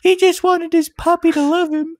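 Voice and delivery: squeaky falsetto fake sobbing; hammed-up crybaby squeal; mock crying. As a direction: bawl in a tiny, very high voice. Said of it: "on that note about crying".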